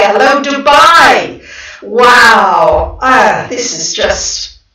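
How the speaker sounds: loud, slow, emotional exclaim; real touched excitement; overwhelmed delight. Real, not acted. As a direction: let the joy almost choke you up. Moved by global viewers.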